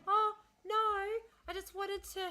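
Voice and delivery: high-pitched imitation of a flustered apologetic woman. Imitating the apologetic receptionist, high and flustered. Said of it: "And she's like".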